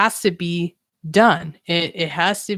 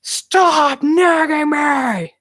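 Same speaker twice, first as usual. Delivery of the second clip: put-on high, slow, strained shout at a nagging child; frustrated mom yelling, voice cracking; angry yell; 9 semitones higher, 3 dB louder, much slower than usual. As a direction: snap at him, fed up and at the end of your rope. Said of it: "just out of anger and frustration".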